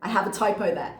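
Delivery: ordinary talk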